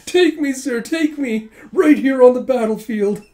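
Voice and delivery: high, loud hammed-up swooning; mock gushing plea. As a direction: plead like an overexcited admirer. Joking gushing plea to the general.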